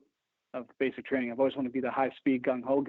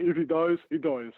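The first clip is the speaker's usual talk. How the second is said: louder second attempt at a bad Russian accent; Drago accent retry; 4 semitones higher, 3 dB louder than usual. Guest retrying the movie line after botching it, hammed-up Russian accent. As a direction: push harder on the mock Russian accent. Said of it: "Can't get a fucking accent right".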